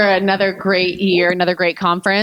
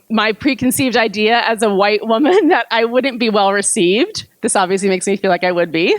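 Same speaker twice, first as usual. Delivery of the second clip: ordinary delivery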